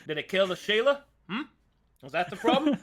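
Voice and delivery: high, loud Aussie interrogation; Australian accent. Acted. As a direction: question them like an Australian demon hunter. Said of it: "The legend of the Aussie Metsus".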